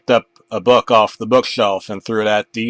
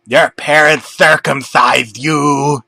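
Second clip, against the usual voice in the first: slow, louder, far-off shout; 3 semitones higher, 7 dB louder, slower than usual. Acted. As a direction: yell the revelation. Buster reveals shocking truth.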